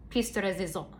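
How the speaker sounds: a lightly tried French accent on piece de résistance; mock French, half-hearted. Shown as a demonstration. Lightly French-accented phrase to the viewers. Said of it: "I try to do the French".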